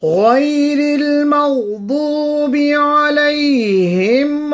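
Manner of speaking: ولا الضالين with the alif before the doubled lam drawn out very long, very slow; five-second madd. Shown as a demonstration. Teacher demonstrating the long madd lazim in الضالين, very slow. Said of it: "will be lengthened for five seconds".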